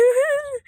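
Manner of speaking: short high laugh; self-amused laugh. Acted. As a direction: laugh at your own joke. Asks if joke was funny, laughs. (अपने ही मज़ाक पर ऊँची हँसी)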